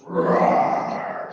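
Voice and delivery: loud breathy mock roar; panther roar. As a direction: roar like a big cat. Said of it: "My panther goes".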